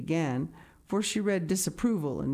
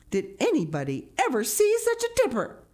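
high-pitched, scandalized outcry; horrified matron; horrified exclamation; 10 semitones higher, 4 dB louder than usual. A narrator's reading. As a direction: exclaim it, utterly appalled. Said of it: "exclaimed the horrified Missus Rachel".

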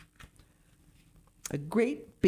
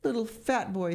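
thundered; 6 semitones higher than usual. Acted. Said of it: "thundered the elephant".

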